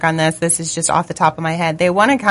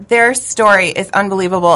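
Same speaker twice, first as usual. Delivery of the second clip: ordinary talk, nothing marked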